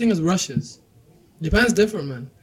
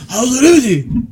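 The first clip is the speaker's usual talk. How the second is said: made-up Japanese gibberish; fake foreign language; 5 semitones higher, 6 dB louder, faster than usual. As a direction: fake Japanese, higher pitched. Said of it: "That's not Japanese".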